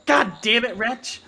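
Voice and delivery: high, loud exasperated outburst; annoyed goblin. Acted. Goblin knocked over by his companion, acted annoyance.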